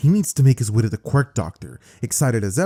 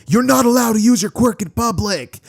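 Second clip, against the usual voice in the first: higher, louder angry shout; angry bystander yell; 9 semitones higher, 4 dB louder than usual. Acted. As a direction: shout him down from the crowd. Bystander yells at Deku.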